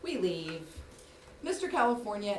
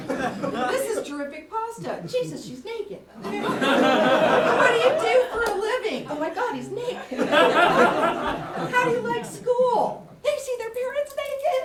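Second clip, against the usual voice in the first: awkward high chatter; 12 semitones higher, 6 dB louder than usual. Retold or imitated. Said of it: "what do you say".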